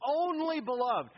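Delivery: "only beloved" high, loud and stretched out; emphatic stress. Shown as a demonstration. Preacher stressing the perfect love, "only beloved" hit hard. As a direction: proclaim "only beloved" slowly at full force. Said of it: "stressing this perfect love relationship".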